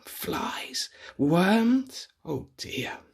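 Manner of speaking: soft whisper; whispered aside. Acted. Reader whispering gross picture items. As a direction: breathe it out grossed out.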